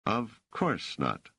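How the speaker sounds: slow, drawn-out echo with light sarcasm; understated sarcastic drawl; tinge of sarcasm. A narrator's reading. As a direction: repeat it back slowly, faintly mocking. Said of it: "just a tinge of sarcasm in his voice".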